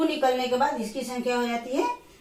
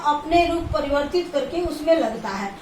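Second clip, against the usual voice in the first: normal talking voice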